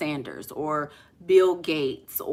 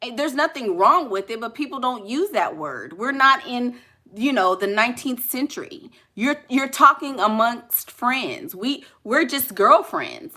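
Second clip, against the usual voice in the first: ordinary talk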